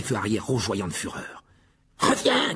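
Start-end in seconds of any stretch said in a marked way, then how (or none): 2.0-2.5 high, pleading shout; desperate high-pitched yell; cried out. A narrator's reading. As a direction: call after someone who is leaving. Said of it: "cria Ron".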